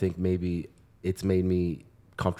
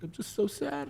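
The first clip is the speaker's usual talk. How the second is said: imitating crying sister; 9 dB quieter than usual. Quoting crying pregnant sister.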